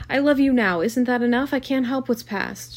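loud, high desperate pleading; distressed outburst; crying out. A narrator's reading. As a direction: plead helplessly, easing off toward the end. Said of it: "she cried to Gatsby".

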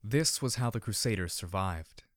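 usual speaking voice

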